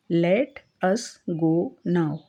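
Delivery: very slow, word by word; slow teaching read. Shown as a demonstration. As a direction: read it slowly for students. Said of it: "teacher reads phrase". (धीरे-धीरे पढ़कर)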